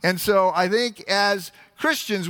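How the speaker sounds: normal talking voice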